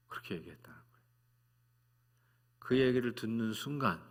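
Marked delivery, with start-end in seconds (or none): 0.1-1.1 hushed whisper of awe; softly whispered aside. Real, not acted. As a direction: drop to a breathy whisper. Hushed aside after daughter's line.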